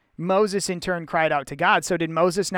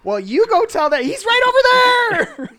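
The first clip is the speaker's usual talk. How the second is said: much higher, louder squeaky bickering; mock petty God; 6 dB louder than usual. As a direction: snipe it like a petty squabbler.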